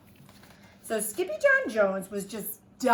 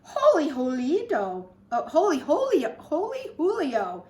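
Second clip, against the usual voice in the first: raised, loud kitten exclamation; squeaked; 6 semitones higher, 6 dB louder than usual. A narrator's reading. Kitty boy sees chihuahuas in the mirror, lightly squeaked. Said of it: "squeaked the kitty boy".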